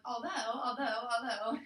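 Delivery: loud, repeated, mock-nervous although; hammed-up speech crutch; nervous filler word. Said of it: "I'm like".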